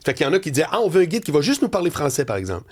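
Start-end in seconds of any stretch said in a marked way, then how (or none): none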